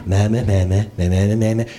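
low droning congregational chant; creepy monotone. Retold or imitated. Congregation reciting the Our Father in unison, creepy monotone. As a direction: drone it low on one note, like rote recitation. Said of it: "in that creepy monotone voice".

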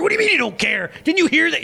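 high mock indignation; hammed-up disbelief at someone not caring; indignant. Pastor mocking his riled self at an indifferent person, high indignation. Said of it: "if the person doesn't care".

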